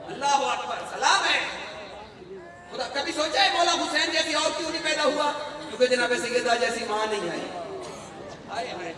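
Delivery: high, loud impassioned sermon; a majlis preacher rousing the mourners, loud and high; preaching at full force. Acted. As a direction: preach it out to the whole hall. Preaches to the congregation.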